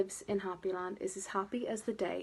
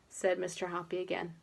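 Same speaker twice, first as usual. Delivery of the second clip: ordinary delivery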